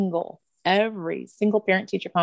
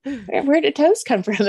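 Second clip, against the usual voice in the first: mock baffled, pitched up; higher, questioning disbelief; puzzled; 7 semitones higher than usual. Mom playfully questioning her son's nickname, puzzled.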